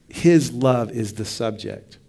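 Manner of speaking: slow, "His" hit hard and falling; stress on his. Shown as a demonstration. Preacher emphasizing "His" to his class. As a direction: punch "His" and let it fall. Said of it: "the point of emphasis is that it's his love".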